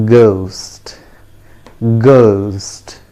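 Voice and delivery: "ghost" with a plain hard g, drawn out; teacher's careful "ghost", unaspirated g, falling; correct pronunciation. Shown as a demonstration. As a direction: model "ghost" slowly, no h sound after g. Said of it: "The correct pronunciation is".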